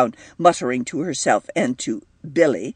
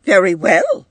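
loud, higher, offended retort; rising temper; 5 semitones higher, 6 dB louder than usual. A narrator's reading. Edith answers being told to go home, temper rising. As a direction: answer back with temper climbing. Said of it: "her own temper rising".

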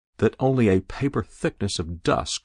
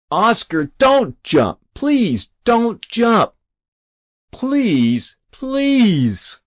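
rising, louder begging, slowing on please; desperate pleading; 5 dB louder, much slower than usual. Acted. Rabbi on his knees begging the boy not to jump, desperate pleading. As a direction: beg him not to jump, voice straining higher. Rabbi begs Ozzie again.

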